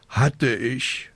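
drawn-out sighed admission; resigned sigh. A narrator's reading. Witch owning up about her lost broom, sighing. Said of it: "seufzte die kleine Hexe".

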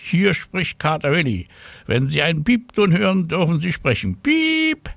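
high announcement, then piercing beep; cat playing answering machine. A narrator's reading. Cat answering the telephone, high mock answering-machine voice. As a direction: recite it high and end on a squeaked beep.

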